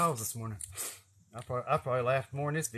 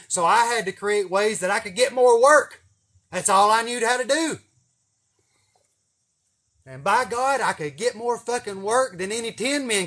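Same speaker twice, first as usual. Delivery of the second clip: high, loud preaching pride; fired-up boast; 9 semitones higher, 9 dB louder than usual. Real, not acted. Boasting to viewers how hard he worked, high and loud. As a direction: proclaim it like a preacher on fire. Boasting about his work ethic.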